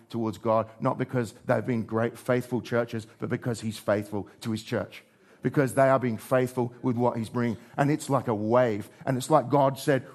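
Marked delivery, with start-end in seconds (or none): none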